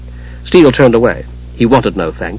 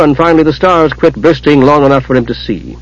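usual speaking voice

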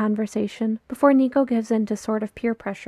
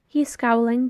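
usual speaking voice

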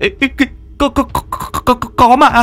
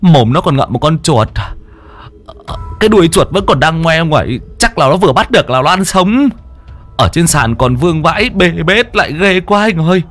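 higher, shaky frightened account; trembling retelling; 7 semitones higher than usual. Acted. Henchman to boss describing the ghost, trembling. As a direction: relive the horror as you tell it. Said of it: "run run kể lại".

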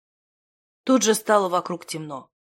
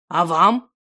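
firm, louder reproach; reproachful; 5 dB louder than usual. A narrator's reading. Wizard questions everyone at once, reproachful. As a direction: turn the question back reproachfully. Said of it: "укоризненно спросил".

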